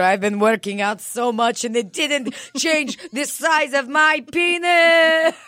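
loud, high, laughing rant about his body; laughing mock rant. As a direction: shout it through laughter as the dad. Voicing Igor ranting.

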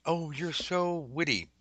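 mock flirty compliment.

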